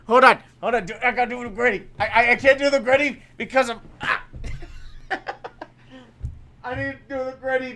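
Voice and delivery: normal talking voice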